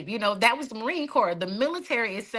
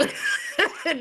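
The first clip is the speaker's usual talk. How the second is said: burst of laughter; 9 semitones higher than usual. Real, not acted. Laughs after her own joke.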